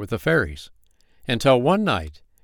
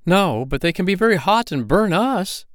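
higher, louder worried objection; fretful goblin; 10 semitones higher, 4 dB louder than usual. Acted. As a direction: protest anxiously.

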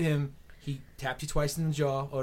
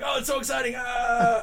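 mock excited; 8 semitones higher than usual. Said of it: "you're just like".

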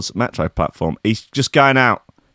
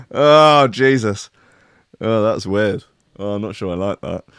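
ordinary talk, nothing marked

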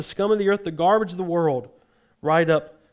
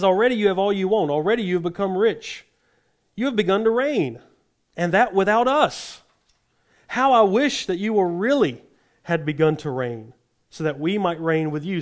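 mocking praise, pitched up and punchy; sarcastic; 2 semitones higher than usual. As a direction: sarcastically congratulate them on having it all. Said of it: "Totally sarcasm. So I want you to listen to his tone".

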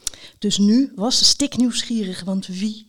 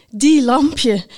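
louder, higher, voiced exclamation; 4 semitones higher, 6 dB louder than usual. A narrator's reading. Knight repeats it while cycling off, out loud. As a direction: say it aloud with fond emphasis. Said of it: "nog eens hardop".

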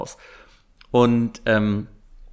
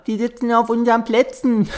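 mock quote; 10 semitones higher, 3 dB louder than usual. Quoting people in cinema.

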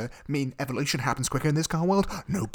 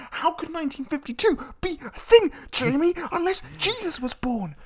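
incredulous outburst. Real, not acted. Cohost ranting to Jamie, high and incredulous. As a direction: pitch it up in mock disbelief. Mock outrage at the film's logic.

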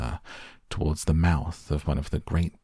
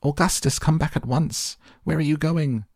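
high-pitched panicked motherly calls; high mock scream; 10 semitones higher than usual. A narrator's reading. Mother calling son back from the pipe, high panic. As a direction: call after him in a high voice. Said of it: "screamed Missus Gloop".

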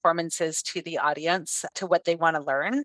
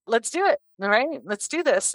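excited; 7 semitones higher than usual. Retold or imitated. Excited reply to offer of teaching.

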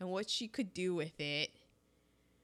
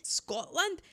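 high rising Scotland? in disbelief; incredulous; 7 semitones higher than usual. Real, not acted. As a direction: toss Scotland? back, pitched up. Echoes partner in disbelief.

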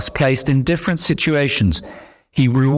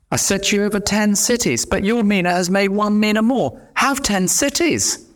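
high, beaming praise of the master, higher; delighted master; 8 semitones higher than usual. Acted. Master hands servant ten cities, delighted and higher. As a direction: announce the reward with glee.

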